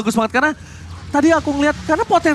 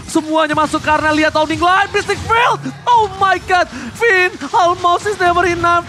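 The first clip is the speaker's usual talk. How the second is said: hyped caster play-by-play; 5 semitones higher than usual. Acted. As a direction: call the teamfight at full hype. Hype esports play-by-play.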